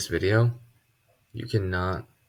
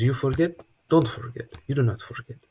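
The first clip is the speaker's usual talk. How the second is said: Russian teacher impression; 6 semitones higher than usual. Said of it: "I had a Russian math teacher that would be like".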